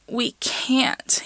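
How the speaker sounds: high whispered whine; whined. A narrator's reading. Patrick whining to Pete before the show, high whisper. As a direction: plead it out, high and airless. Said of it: "he whined".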